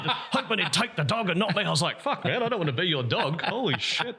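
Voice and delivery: high, animated retelling voice; interviewed crocodile attack survivor. Retold or imitated. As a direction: imitate an excited local telling his story. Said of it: "he was just like".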